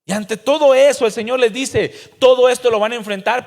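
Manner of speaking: loud, high, impassioned sermon; a preacher thundering warnings at the congregation; fiery preaching. Acted. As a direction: preach it out at full volume. Preaching at full force.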